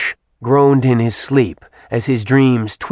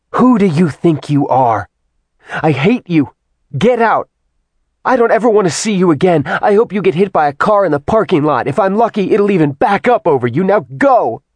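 furious high shouting tirade; 7 semitones higher, 4 dB louder than usual. A narrator's reading. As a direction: spit it out in a fast fury. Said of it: "Furious even".

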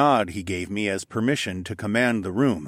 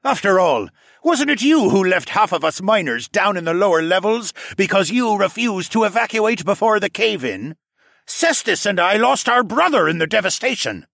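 loud, higher, bitter lizard miner; bitter accusation; 11 semitones higher, 7 dB louder than usual. Acted. Miner blaming the captain for his brother's death, loud and bitter. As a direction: accuse him, grief-fuelled.

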